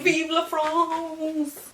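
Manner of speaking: high, very slow mock French rally cry; revolutionary cheer. Reader voicing a Paris rebel, hammed-up cheer. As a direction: draw it out like a proud revolutionary. Revolutionary shout in dramatic reading.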